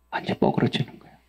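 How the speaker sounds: hushed whisper on 뻐그러지는, breath only; preacher drops to a whisper. Acted. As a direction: lean in and whisper 완전 뻐그러지는 거예요. Whispered then repeated aloud.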